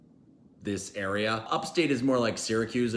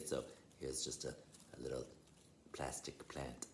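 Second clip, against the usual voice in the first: quiet aside; 13 semitones lower, 21 dB quieter than usual. Real, not acted. Muttered aside about prop.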